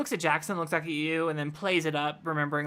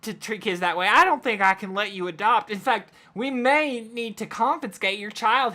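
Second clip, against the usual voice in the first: raised, loud voice of an intervening lawman; sheriff speaking up; 4 semitones higher, 6 dB louder than usual. Acted. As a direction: step in firmly as the sheriff. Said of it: "Sheriff Jackson speaks up".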